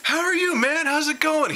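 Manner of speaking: high, bubbly friendly greeting; cheerful actor greeting. Retold or imitated. Actor happily greeting the fan, high and bubbly. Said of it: "he's like".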